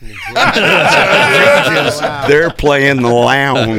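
laughing loud and slow. Real, not acted. Laughing as the friend guesses the [name] were in the lounge. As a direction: laugh out the reveal. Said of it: "Met the [name]?".

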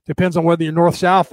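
ordinary talk, nothing marked

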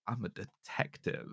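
hushed, mock-serious private eye; a lightly voiced noir detective. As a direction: play the hard-boiled detective, pulled way back and soft. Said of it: "I was like".

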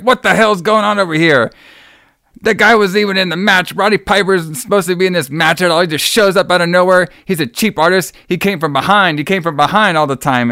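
high-pitched ranting imitation; mock outrage. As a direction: protest like an indignant wrestling manager. Acting out manager's outraged protest.

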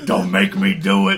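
mock menace. Mock threatening kiss.

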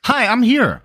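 high, loud, showy "Hi, I'm here."; excited entrance. Acted. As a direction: make a big, bright entrance. Guest makes a big entrance.

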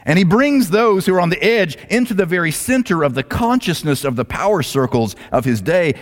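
ordinary talk

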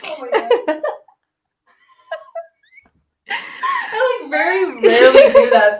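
high laughing squeal. Real, not acted. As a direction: giggle through the words. Still laughing after the whistle.

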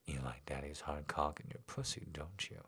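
low, soft, teasing question; low teasing daddy voice. A narrator's reading. Daddy teasing his girl in bed, low and soft. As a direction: tease her quietly from close by.

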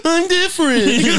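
high, slow, laughing mock whine; laughing mockery. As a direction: cackle it out mockingly. Mocking the line.

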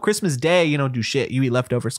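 usual speaking voice

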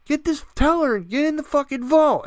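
high, loud imitated shouting; mock yell. Gang yelling at Arthur inside the bank, high mock shouting. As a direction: bark the orders like the gang. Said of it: "they're yelling".

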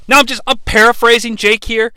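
usual speaking voice

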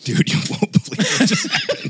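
excited whisper. Retold or imitated. Telling brother excitedly.

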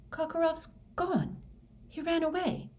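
high-pitched, startled voice; startled realization. Acted. Harry learns Karkaroff fled, startled. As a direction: take it in with high, startled disbelief. Harry reacts to news of escape.

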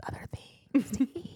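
soft hissing monster whisper; whispered snake voice. Acted. Host voicing the Naga taunting Feyre, soft snake whisper. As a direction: whisper it like a creeping serpent.

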